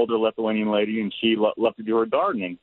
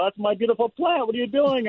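much higher, mock-distraught old lady; upset grandma; 12 semitones higher than usual. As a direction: plead for the plant like a hurt grandma, hammed up. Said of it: "and saying".

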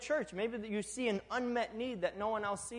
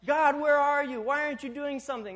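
exasperated outcry; 6 semitones higher, 7 dB louder than usual. Retold or imitated. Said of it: "it's like".

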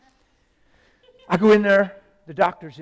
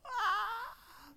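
high falsetto wail of someone crying; long high weepy "ah"; imitated crying wail. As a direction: mimic a wife bursting into a high cry. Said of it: "And she went".

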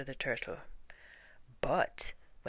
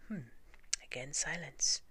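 usual speaking voice

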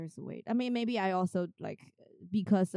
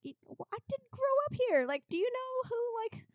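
much higher and louder, turning the question back on them; playfully outraged, mirroring their shock; mock indignant comeback; 10 semitones higher, 5 dB louder than usual. Turning the tables on Americans, hammed-up high indignation. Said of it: "I'm like".

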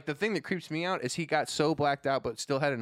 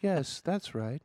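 calm AI voice; 4 semitones higher, slower than usual. Retold or imitated. Smart house AI speaking.

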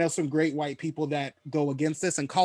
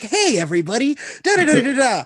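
higher, louder chirpy director greeting; upbeat, pitched-up group hello; 9 semitones higher, 6 dB louder than usual. Retold or imitated. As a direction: welcome the room brightly as a director.

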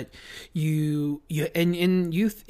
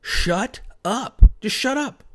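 sharp, higher-pitched mock order to shut up; coach shutting him down; 7 semitones higher, 4 dB louder, slower than usual. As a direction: snap at the player like a fed-up coach. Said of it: "and he's just like".

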